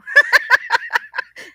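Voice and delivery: a loud, high cackle; high burst of laughter. Real, not acted. Laughing at her own joke about the F word, high and loud. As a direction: let out a high laughing squeal.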